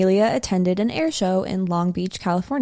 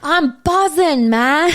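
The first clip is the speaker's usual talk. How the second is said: high, drawn-out fake British; badly faked British, stretched and high; bad British accent; 8 semitones higher, 4 dB louder, slower than usual. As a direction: attempt a hammy British reality-show accent. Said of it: "I can't do an accent".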